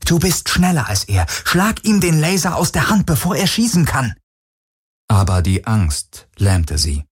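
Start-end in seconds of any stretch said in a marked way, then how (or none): 0.1-4.2 high, urgent self-command; inner scream. Acted. Heroine to herself at gunpoint, screaming inwardly. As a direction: drive yourself to act, voice pitched way up. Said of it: "schrie alles in ihr".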